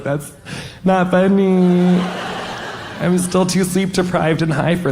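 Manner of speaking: high, stretched-out "not funny"; mock tearful whine. Patient answering staff's joke, high mock tearful whine.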